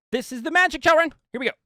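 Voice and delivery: high, loud showman flourish with a laugh; mock showman. Teacher unveiling the magic trick to viewers, high hammed-up showman voice. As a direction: announce it like a ringmaster to kids.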